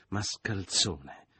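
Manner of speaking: very drawn-out insult; restrained cry. A narrator's reading. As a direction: stretch the insult out slowly. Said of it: "gridò sentendosi mancare il pavimento". (grido trattenuto, strascicato)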